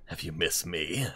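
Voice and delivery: mock-teasing low, soft take on the line; a hint of a friend's voice, teasing; imitating a friend's greeting. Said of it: "I thought you were gonna go with the".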